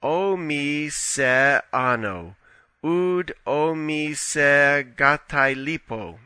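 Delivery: slow careful Greek pronunciation, "O me se ano, oud o me se gatai lipo" read syllable by syllable; deliberate classroom Greek reading, each word of "O me se ano" separate; reading Koine Greek. Shown as a demonstration. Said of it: "is written".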